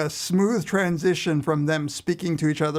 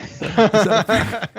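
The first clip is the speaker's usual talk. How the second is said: laughing; 7 semitones higher, 7 dB louder, slower than usual. Real, not acted. Joking recommendation, laughing.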